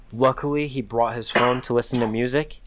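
usual speaking voice

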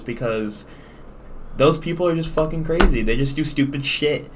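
ordinary talk

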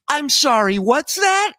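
imitating Rosie. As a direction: squeak it out in disbelief. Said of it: "And she just goes".

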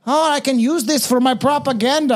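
a hammed-up German accent for the propaganda line; shitty German accent. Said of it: "That was a shitty German accent".

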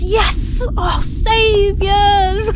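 high shout of rescue, then a slow drawn-out cry of thanks; overjoyed cry to rescuers; joyful shout. Acted. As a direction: cheer at being saved. Someone hailing the ship.

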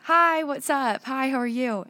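loud sing-song small talk; cheery American greeting. Retold or imitated. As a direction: chirp it at a stranger. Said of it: "So we'll just say".

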